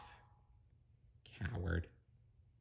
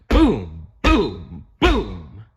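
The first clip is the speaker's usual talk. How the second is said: booming footsteps; 7 dB louder, much slower than usual. Acted. Robot footsteps sound effect.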